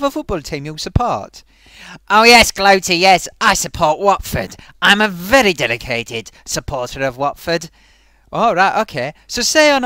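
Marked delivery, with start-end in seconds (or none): 2.1-7.7 loud put-on character voice; a proud hammed-up puppet fan. Puppet tells Gloaty he supports Watford, put-on voice. Puppet states his football team.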